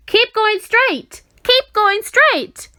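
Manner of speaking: high, loud, slow call; drawn-out, loud directing call; calling advice. A narrator's reading. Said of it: "advised the voice".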